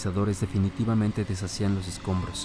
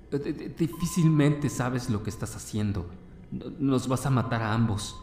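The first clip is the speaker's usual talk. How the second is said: frightened stutter; 4 semitones higher than usual. Acted.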